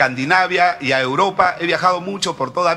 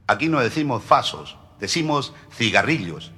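much lower, softer flat correction; lower, softer Spanish clerk; dry correction; 8 semitones lower, 6 dB quieter than usual. Acted. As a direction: correct him dryly from below.

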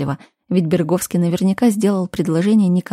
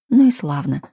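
ordinary delivery, nothing marked

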